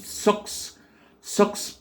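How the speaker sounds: "socks" with a short open o; English model word "socks". Shown as a demonstration. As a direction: keep the vowel of "socks" short. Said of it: "un O court".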